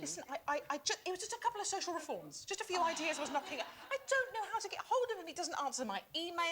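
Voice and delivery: high, rushed self-justification; mock-desperate, hurried excuses; flustered pleading. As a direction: plead your case in a mounting fluster. Acting desperate excuses for obsessive calls.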